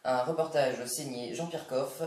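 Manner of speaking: TV news presenter voice; smooth evening-news read; news anchor parody. Acted.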